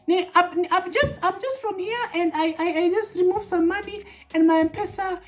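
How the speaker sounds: very high, distressed lady voice; upset lady customer. Retold or imitated. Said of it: "So the first lady comes".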